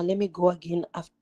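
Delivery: ordinary delivery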